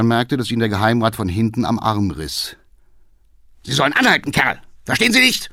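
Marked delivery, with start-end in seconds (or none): 3.6-5.5 loud, rising angry yell; furious shout. Acted. Councillor red with rage yelling at the cabman. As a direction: scream at him to stop. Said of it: "schrie der Alte kirschrot vor Wut".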